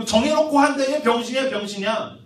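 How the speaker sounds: loud, high taunting; high jeering that drops at the end; finger-pointing jeers. Acted. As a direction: pile on insults like a mocking crowd. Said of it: "세상에서 너한테 어떤 손가락질하고".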